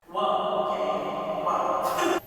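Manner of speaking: whispered, taken-aback reaction. Retold or imitated. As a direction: whisper it, as if caught off guard. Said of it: "kinda taken aback".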